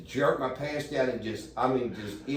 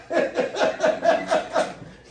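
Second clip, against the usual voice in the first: high breathless wheezing laugh; 6 dB louder than usual. Real, not acted. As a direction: burst into a high silent laugh. Laughs at own story.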